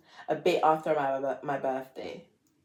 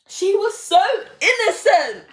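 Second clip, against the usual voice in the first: much higher and louder, a hammed-up lament; mock wail; 11 semitones higher, 7 dB louder than usual. Lamenting her innocent past self, a hammed-up wail. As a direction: wail it like overdone mourning.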